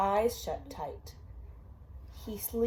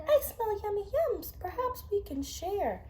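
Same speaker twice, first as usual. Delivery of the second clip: much higher, greedy little badger; 12 semitones higher than usual. Parent voicing the badger for a child, higher eager voice. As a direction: sniff out the treats in a high creature voice.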